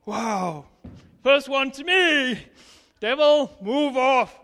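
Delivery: loud commanding shout at the devil; rebuking the devil. Retold or imitated. Retelling her victory to the congregation, loud rebuke.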